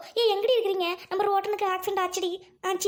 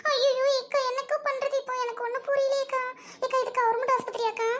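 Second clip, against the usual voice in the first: higher-pitched acted distress; tearful wailing; 6 semitones higher than usual. Friend at the hospital asking what to do, tearful. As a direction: plead helplessly, as if crying. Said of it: "எல்லாரும் அழுவாதீங்க".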